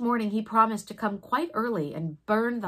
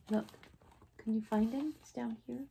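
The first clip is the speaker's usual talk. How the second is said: quiet aside; 2 semitones higher, 6 dB quieter than usual. Real, not acted. Reader points out picture to child.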